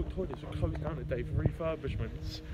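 normal talking voice

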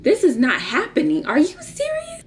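rising into very high incredulity; acted disbelief. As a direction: climb to a squeaky, appalled question. Disbelief on discovering his size.

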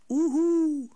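loud high hooting owl call. A narrator's reading. Snow owl hooting over the plain, loud hoot. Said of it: "riep de sneeuwuil weer".

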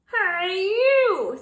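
high, loud grouchy call; grouchy hail. A narrator's reading. Grouchy ladybug accosting the yellow jacket, high and loud. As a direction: call out to pick a fight.

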